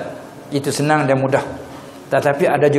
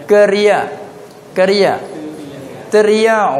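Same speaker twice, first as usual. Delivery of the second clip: raised-voice call, held short of a full shout; calling from afar; 5 semitones higher than usual. Shown as a demonstration. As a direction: call out to someone in the distance. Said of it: "kalau yang jauh ni".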